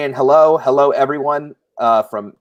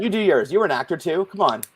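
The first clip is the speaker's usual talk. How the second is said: ordinary talk, nothing marked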